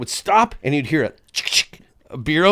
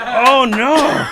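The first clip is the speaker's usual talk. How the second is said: high, drawn-out mock wail; horrified realisation; 8 semitones higher, 4 dB louder than usual. Man seeing his face in the mirror, hammed-up horror. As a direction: re-enact dawning horror.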